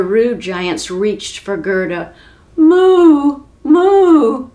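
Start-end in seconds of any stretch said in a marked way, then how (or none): 2.6-4.5 loud, high, frightened moos; cow crying moo. Acted. Gerda the cow crying out as giants grab her, loud moos. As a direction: moo in alarm like a scared cow. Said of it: "Gerda cried".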